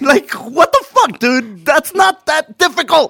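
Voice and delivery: laughing disbelief. Real, not acted. Mocking a dad's lost dream.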